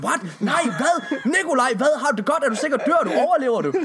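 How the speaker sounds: high, laughing incredulous questions; a friend playing horrified over the news; mock friends' alarm. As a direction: act the shocked friends, half laughing. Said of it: "Så vil ens venner jo sige".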